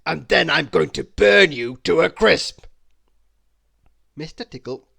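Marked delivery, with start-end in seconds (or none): 0.0-2.7 loud dragon roar; booming menacing dragon; dragon threat. Acted.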